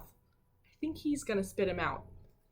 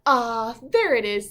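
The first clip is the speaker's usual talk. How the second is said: louder, pleased little pig; found it; 9 dB louder than usual. Acted. Preston finds his money in the desk, louder. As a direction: relief at finding the money. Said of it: "said Preston".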